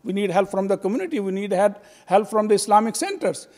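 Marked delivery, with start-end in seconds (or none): none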